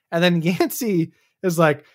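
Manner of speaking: normal talking voice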